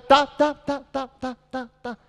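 string of high "ta" bursts, fading away; high staccato "ta-ta-ta". Shown as a demonstration. Coach sounding out the action in a drill, high staccato. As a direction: rattle off high syllables, tailing off.

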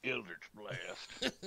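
slow, lightly imitated spell name; mock spell call. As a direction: echo the meme call, drawn out. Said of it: "that's a meme in a D and D community".

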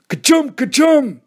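two high, loud play-acted sneezes; mock double sneeze. A narrator's reading. Joe pretending to be Billy on peppered worm, mock sneezes. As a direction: sneeze twice, theatrically. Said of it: "Joe sneezing".

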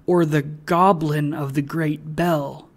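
ordinary delivery, nothing marked

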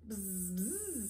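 two soft bee buzzes; soft storybook bee noise. A narrator's reading. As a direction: buzz quietly like a bee. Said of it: "says Bee".

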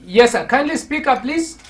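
loud, high-pitched request; calling out. Real, not acted. Host asking a faint caller to speak up, calling out. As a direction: raise the voice sharply to be heard.

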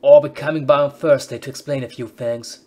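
loud put-on British officer accent. Officer Chip announcing Thursday visit in loud British accent. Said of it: "That's how British people talk".

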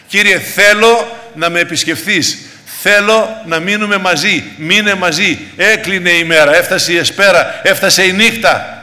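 loud, pleading pulpit voice; insistent sermon appeal, pushed hard; impassioned preaching. Acted. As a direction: cry out the plea as a fervent preacher. Preacher voices an urgent plea.

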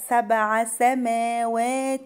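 "سبع" with an echoing bounce on ب; qalqalah on ب. Shown as a demonstration. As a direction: bounce the ب in "سبع", then chant on. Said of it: "الباء هنا هتبقى مقلقلة".

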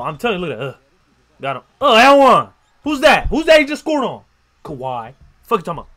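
ordinary talk, nothing marked

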